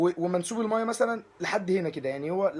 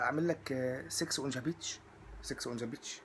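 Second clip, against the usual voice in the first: soft, low, secretive voice; hushed offer; 5 semitones lower, 10 dB quieter than usual. Retold or imitated. Scene: bartender quietly offers a cocktail to the guest, hushed. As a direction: lean in and offer it under your breath.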